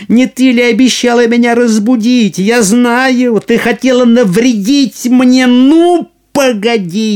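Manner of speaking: high, loud angry shriek; shrill nagging grandma. Acted. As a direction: scold at full pitch, furious. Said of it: "как закричит она".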